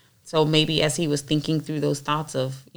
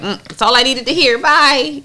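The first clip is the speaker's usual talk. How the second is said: loud, high-pitched acted brush-off; mock brusque dismissal; 9 semitones higher, 9 dB louder than usual. Teacher mimics Ziba rushing off from David, loud mock dismissal. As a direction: act out someone cutting talk short and leaving. Acts out Ziba's hasty exit.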